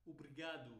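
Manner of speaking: European "obrigado" with swallowed vowels; quick closed pronunciation. Shown as a demonstration. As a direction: clip obrigado short, Portugal style. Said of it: "mais rápida e fechada".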